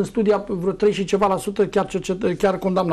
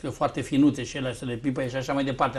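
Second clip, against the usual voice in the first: sly, hushed innuendo, dropping softer and lower; ironic insinuation; 6 semitones lower, 7 dB quieter than usual. Real, not acted. Guest mocking the mayor's supporter to the host, ironic hushed innuendo. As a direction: slip the insinuation in low and knowing. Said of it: "Sunteți ironic".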